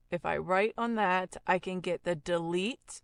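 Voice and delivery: normal talking voice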